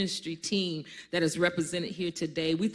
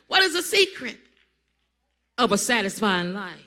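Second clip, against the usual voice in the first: emphatic repeat; 3 semitones higher, 7 dB louder than usual. Shown as a demonstration. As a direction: fling the question out. Repeats question after laugh.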